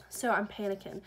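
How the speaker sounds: ordinary delivery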